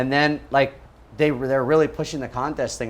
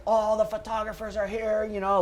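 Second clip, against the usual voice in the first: eager dad; 9 semitones higher, 3 dB quieter than usual. Retold or imitated. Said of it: "my dad's like".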